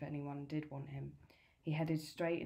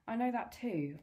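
surprised; 6 semitones higher, 4 dB louder than usual. A narrator's reading. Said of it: "I said, surprised".

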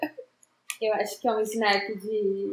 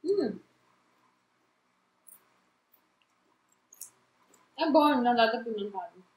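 talking while chewing; crunchy snack mid-bite; mouth full of chips. Real, not acted. Seen 0: Right speaker's jaw works on a chip eaten just before speaking.

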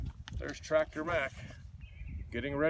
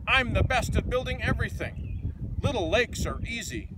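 proud, louder, higher bragging; crowing boast; 8 semitones higher, 5 dB louder than usual. Acted. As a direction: crow about how great you are. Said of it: "crowed Deke".

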